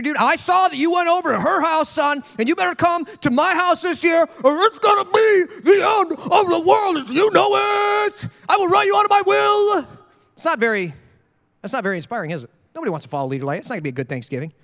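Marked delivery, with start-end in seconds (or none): 0.0-9.8 high, loud mock parent threatening; demanding parent. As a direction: bluster like an angry dad, pitched up. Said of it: "Do you inspire your kids".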